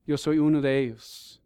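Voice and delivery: ordinary delivery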